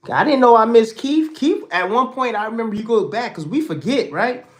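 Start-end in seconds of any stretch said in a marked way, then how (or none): 0.1-3.1 higher and louder, fired up; excited praise. Real, not acted. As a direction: burst out with delight. Excited about Keith's return.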